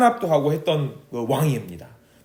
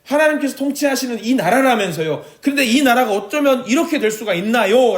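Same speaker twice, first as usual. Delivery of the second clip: high, pressing complaint lifted above her own voice; a prophet's raised, indignant protest; protesting to God; 7 semitones higher than usual. Acted. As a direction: argue with God, demanding an answer. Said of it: "라고 하나님께 따졌던".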